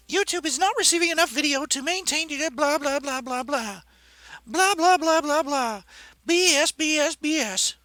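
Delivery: very high mocking voice. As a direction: parrot the message in a high sneer. Mocking an error message.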